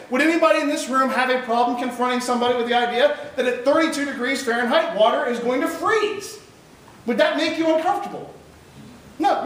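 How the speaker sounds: ordinary delivery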